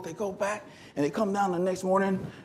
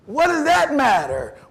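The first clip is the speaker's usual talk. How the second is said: high, raised-voice retort; indignant challenge; 10 semitones higher, 6 dB louder than usual. Retold or imitated. Coach challenging runners over their ranking, indignant retort. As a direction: push back hard, pitched up and loud.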